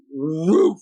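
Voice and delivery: imitated startled bear woof; sudden, high whoop like a spooked bear; loud bear yelp. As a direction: yelp out a mimicked animal woof. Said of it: "had this loud yelp".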